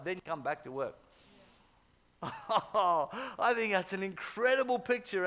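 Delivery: ordinary talk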